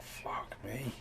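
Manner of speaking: muttered quote. Retold or imitated. Friend Raoul pulled out to sea, quiet despairing mutter. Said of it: "he's like".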